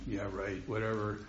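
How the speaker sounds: sarcastic dismissal. Retold or imitated. Dismissing abuse memories, sarcastic.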